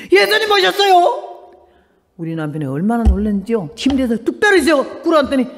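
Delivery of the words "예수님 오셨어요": startled cry. Retold or imitated. 잠에서 깨어 놀라 외침.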